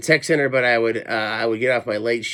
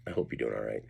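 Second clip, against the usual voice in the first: soft aside; 13 semitones lower, 13 dB quieter, faster than usual. Real, not acted. Quiet aside, voice drops away.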